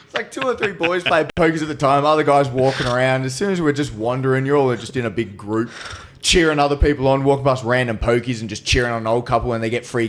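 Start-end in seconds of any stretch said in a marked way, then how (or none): none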